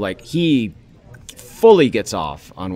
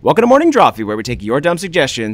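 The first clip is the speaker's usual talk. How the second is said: show host intro; 4 semitones higher, 8 dB louder than usual. Acted.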